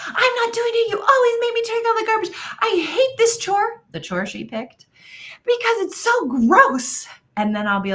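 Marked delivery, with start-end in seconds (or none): 0.1-3.8 put-on much higher, whining teen complaint; whiny daughter. Mom imitating her daughter refusing the garbage chore, high whiny voice. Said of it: "people will come be like". 5.5-7.1 put-on much higher, whining teen complaint; whiny daughter. Mom imitating her daughter refusing the garbage chore, high whiny voice. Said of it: "people will come be like".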